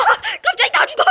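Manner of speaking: high, laughing disbelief. Real, not acted. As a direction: crack up while saying it. Laughs at sudden deaths.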